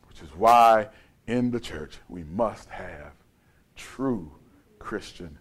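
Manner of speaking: very low and soft, broken by pauses; hushed, solemn preaching. Real, not acted. Preacher closes on why fellowship matters, hushed and solemn. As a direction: let each word sink quietly.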